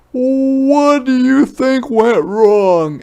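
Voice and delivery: high, loud, slow question; a father gently probing; high loud fatherly question; Papa asking. Acted. Papa voiced.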